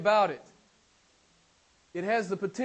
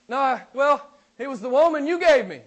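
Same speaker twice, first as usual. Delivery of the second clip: higher, louder defensive whine; Adam squirming out of blame, pitched up; Adam's excuse; 7 semitones higher, 9 dB louder than usual. Retold or imitated. Adam excuses himself to God.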